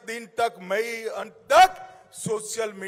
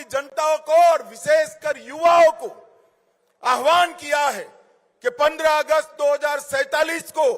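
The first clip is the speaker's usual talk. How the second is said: loud rally oratory; booming political rally speech; 9 semitones higher, 7 dB louder than usual. Acted. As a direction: rouse the crowd from the stage.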